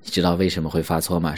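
ordinary talk, nothing marked

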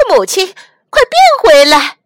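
very loud, high, frantic yell; panicked shout. Acted. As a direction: scream at her to change back. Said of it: "他大叫".

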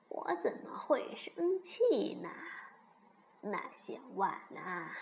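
put-on soft, halting old granny voice; 熊婆婆，慈祥安慰. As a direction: reassure the children gently, with long pauses. (婆婆轻声慢慢地安慰)

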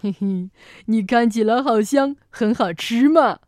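low, greedy dinosaur voice with a snicker; drooling monster licking its lips; drooling T. rex. Acted. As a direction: slurp it out like a hungry T. rex eyeing lunch. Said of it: "霸王龙流着口水说".

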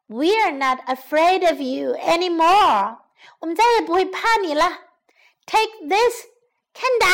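put-on high, defiant storybook girl; loud defiance. Farmer and daughter confronting the lion, loud and defiant. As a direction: declare it boldly, rising to a high attack cry. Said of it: "大声地说".

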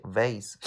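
"vase" as vayz, drawn out; open-syllable pronunciation. Shown as a demonstration. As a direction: slowly say "vase" with a long ay. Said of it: "E结尾的应该读".

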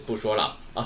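normal talking voice

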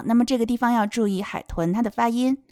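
normal talking voice

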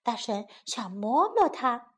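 plain reading voice

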